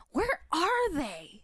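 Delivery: slow, drawn-out, high question; dramatic slow line. Acted. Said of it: "I'm starting with Maury saying".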